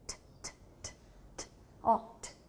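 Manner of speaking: voiceless tapped T, t, t; t sound. Shown as a demonstration. Teacher showing the class the T sound, soft voiceless taps. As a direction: tap out a soft t with no voice. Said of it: "Isso é som".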